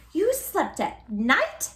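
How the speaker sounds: high, slow astonished question; gasping. A narrator's reading. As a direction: gasp it out in disbelief. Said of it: "gasped another".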